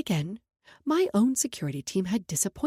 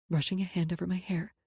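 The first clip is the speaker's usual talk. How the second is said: plain reading voice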